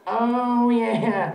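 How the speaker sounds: high, loud mock-sultry oh yeah; fake sexy voice. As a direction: ham up a fake turned-on voice. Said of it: "it was still fake".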